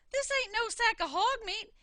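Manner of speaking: wife screaming at what fell out. Acted. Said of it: "she screamed".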